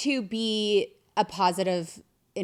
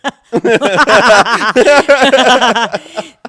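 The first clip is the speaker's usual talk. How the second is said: long, loud burst of cackling; loud laughter; 5 semitones higher, 17 dB louder than usual. Real, not acted. Asked how many secrets, real uproarious laughing. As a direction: burst out laughing, much louder.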